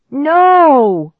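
high, very loud terrified scream; horrified shriek. A narrator's reading. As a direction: scream in horror. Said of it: "A horrified shriek tore from my throat".

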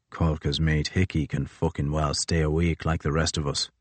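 a hint of a grim, tired commander; gruff captain. Crozier to Fitzjames, low and grim.

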